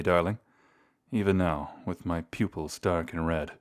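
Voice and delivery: ordinary talk, nothing marked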